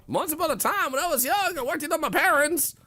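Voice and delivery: high, loud put-on storyteller voice; mock storytelling. Streamer acting out the absurd analogy, high mock-storyteller voice. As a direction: squeal out a silly tale at full volume.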